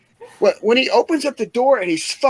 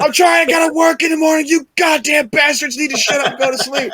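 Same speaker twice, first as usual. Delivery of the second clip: high-pitched mock tirade; grandpa yelling; 9 semitones higher than usual. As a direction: holler like an exasperated grandpa scolding kids. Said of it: "keeps fucking yelling at us".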